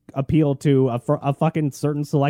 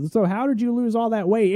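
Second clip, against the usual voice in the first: mocking relative voice; 12 semitones higher than usual. Mocking family relatives asking.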